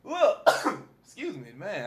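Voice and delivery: raspy after a cough; smoker's rasp. Real, not acted. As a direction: clear the throat, rasp through. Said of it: "My little smoker's cough".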